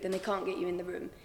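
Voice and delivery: normal talking voice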